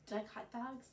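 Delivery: ordinary delivery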